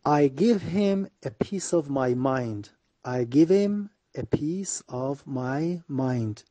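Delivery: very slow, each word separated; very slow model reading. Shown as a demonstration. Teacher slowly modelling the idiom for students. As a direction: drag it out for learners to repeat. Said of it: "teacher models phrase slowly".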